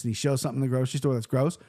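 normal talking voice

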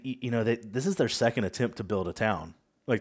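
usual speaking voice

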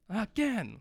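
high-pitched mock coach barking again. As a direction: play a drill coach demanding another try. Coach drills boys, demands answer.

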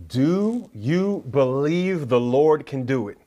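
very slow, drawn-out question; solemn challenge. Real, not acted. Preacher asks listeners about their faith, slow solemn challenge. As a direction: stretch each word out for the congregation.

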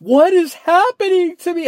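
high, loud panicked cry; mock horror heroine. Imitating a frightened movie girl, high panicked cry. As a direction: ham up a horror-movie victim. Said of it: "this girl is going crazy".

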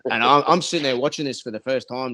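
normal talking voice

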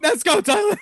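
put-on very high, squeaky toddler voice. Host mocks cohost with a high squeaky toddler voice. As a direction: chirp it like a little kid. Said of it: "Like a toddler".

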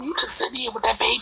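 whispered tease. Real, not acted. Whispered aside.

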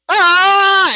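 high, loud jumping yell; a wild yell as he leaps; battle yell. Retold or imitated. Kid dropping through the ceiling, high loud yell. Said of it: "And he's just like".

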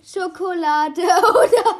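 slow, loud words broken by laughter; laughing. Real, not acted. Laughing in suspense before tasting.

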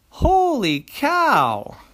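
high, slow drawn-out astonishment; amazed. Acted. Jimmy astonished as the yo-yo becomes a giant globe. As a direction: stretch out the awe.

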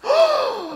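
very high, loud gasp breaking into a laugh; laughing mock gasp. Hearing the topping is cursed too, laughing mock gasp. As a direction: gasp in fake shock, then crack up.

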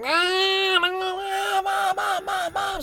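put-on very high wailing kid cries of mom; toddler calling mommy. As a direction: wail for mommy like a toddler losing her screen. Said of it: "starts screaming for mommy".

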